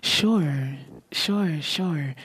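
ordinary talk, nothing marked

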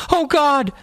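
high panicked cry; terrified cry. A narrator's reading. Boy seeing the monster in the water, high panicked cry. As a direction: cry out in terror. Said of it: "the first boy cried".

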